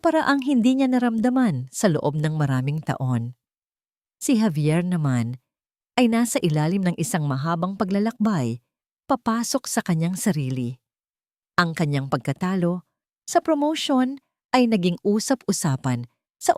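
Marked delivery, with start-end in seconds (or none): none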